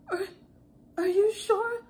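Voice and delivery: high, halting little question; shaky, terrified caller. Retold or imitated. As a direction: imitate a scared customer double-checking. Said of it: "her voice sounded so shaky".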